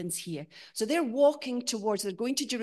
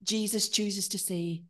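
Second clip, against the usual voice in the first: normal talking voice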